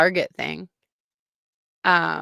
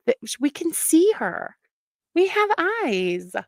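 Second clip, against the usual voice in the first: exasperated; 9 semitones higher than usual. Real, not acted. Exasperated rant.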